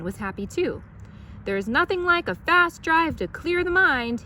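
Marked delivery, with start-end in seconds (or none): 1.4-4.2 loud, high, joyful call over the wind; excited driver calling out; shouting. A narrator's reading. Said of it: "he shouted".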